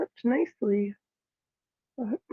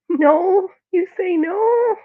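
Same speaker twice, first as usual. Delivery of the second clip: talking to the cat; 8 semitones higher, 6 dB louder, much slower than usual. Real, not acted. Owner answers her cat's refusal in high, slow baby talk. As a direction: ask the cat in a high, slow, mock-hurt voice.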